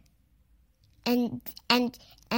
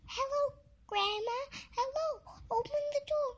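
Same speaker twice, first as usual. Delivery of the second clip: put-on high girl voice; 12 semitones higher, 7 dB quieter than usual. Girl voice at door.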